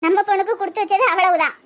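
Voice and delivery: ordinary talk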